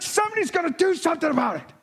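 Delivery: very high and fast, charged declaration; fired-up vow. Retold or imitated. Joe to his pastor, vowing action in a fast fired-up rush. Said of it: "He's foaming at the mouth".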